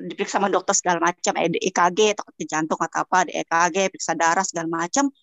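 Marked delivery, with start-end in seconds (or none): none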